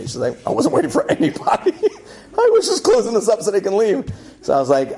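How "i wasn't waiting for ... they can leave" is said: laughing brush-off. Retold or imitated. Gate lady brushing him off.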